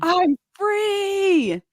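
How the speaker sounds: high, drawn-out cry of release; retold joyful scream. Woman alone after leaving her abusive ex, re-enacted scream. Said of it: "And then I just screamed".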